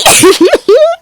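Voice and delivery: high-pitched squealing laugh; giggling outburst. Real, not acted. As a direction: crack up at your own joke. Laughs at her own joke.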